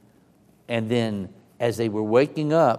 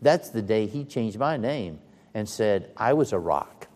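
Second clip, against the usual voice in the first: usual speaking voice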